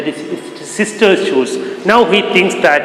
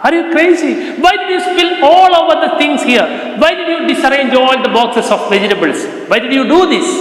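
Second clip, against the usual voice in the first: shouting; 7 semitones higher, much faster than usual. Retold or imitated. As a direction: blast the boy with angry questions. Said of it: "Akbar started to shout at".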